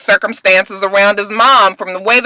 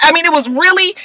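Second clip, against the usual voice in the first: normal talking voice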